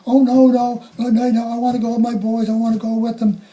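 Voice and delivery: high, loud, hurried pleading; distraught refusal. Retold or imitated. Mother refusing help, pleading to go with her boys. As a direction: refuse through panic. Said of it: "She said".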